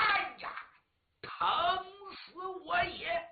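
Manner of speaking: high, drawn-out cry of pain; hammed-up opera-style groan of pain; pained cry on waking. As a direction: wail it out like a wounded general. Huang Feihu wakes in pain.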